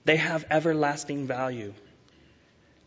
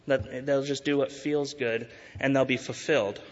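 ordinary delivery

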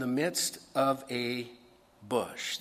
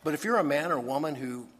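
ordinary delivery

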